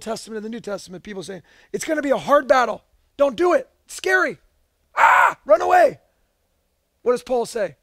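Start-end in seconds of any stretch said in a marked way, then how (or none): 1.7-6.0 hammed-up alarm building to a high, loud cry; mock panic. Preacher voicing Bible people warning of a hard battle, mock panic. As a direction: imitate frightened people crying out a warning.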